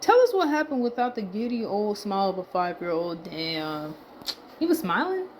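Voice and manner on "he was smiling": high incredulous question; genuinely taken aback; surprised. Real, not acted. Reacting to a tweet.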